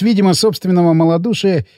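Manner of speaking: usual speaking voice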